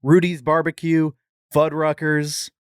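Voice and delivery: ordinary talk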